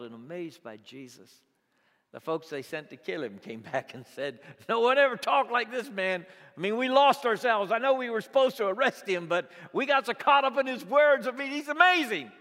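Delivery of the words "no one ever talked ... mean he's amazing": high, loud, breathless awe at his words; imitating awe-struck guards; awed guards. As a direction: act out guards swept away by his words. Guards' report, retold by preacher.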